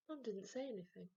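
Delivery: usual speaking voice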